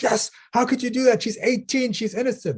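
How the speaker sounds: high-pitched mock outrage; outraged parents. Parents scolding the monk over their daughter, hammed-up outrage. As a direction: act the scandalised mother.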